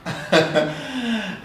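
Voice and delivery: short laugh trailing down. Real, not acted. Host amused by a tweet she just remembered, laughing. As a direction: let out a brief laugh. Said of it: "I'm laughing".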